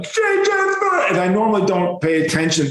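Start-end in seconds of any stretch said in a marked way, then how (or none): 0.0-1.1 high-pitched New York heckler yell; hammed-up hollering, pitched way up; imitated yell. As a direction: call out across a station, mimicking a stranger. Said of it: "I hear some guy yell out".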